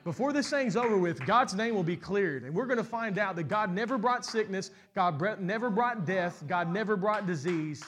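loud, high, building sermon climax; full-force preaching. Acted. Pastor tells the congregation death will end, full-force preaching. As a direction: preach it out to the whole church.

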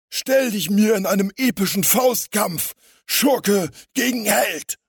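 high, loud villain's dare, slowing grandly at the end; growled challenge. A narrator's reading. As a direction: challenge the hero to a showdown. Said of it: "er grollte Electroman an". (grollende Kampfansage)

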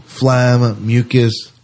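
ordinary talk, nothing marked

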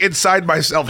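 ordinary delivery

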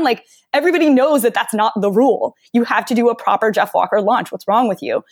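ordinary talk, nothing marked

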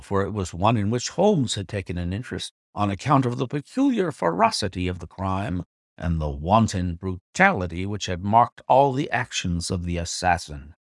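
usual speaking voice

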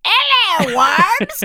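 put-on a cheeky English accent greeting the worms; mock English accent. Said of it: "how good is your English accent?".

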